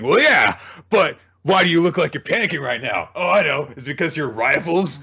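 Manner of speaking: high, sing-song villain teasing; hammed-up taunting of a panicking foe; mocking taunt. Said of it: "doing this mocking taunt thing".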